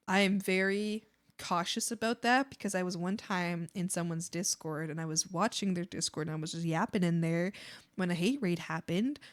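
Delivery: ordinary talk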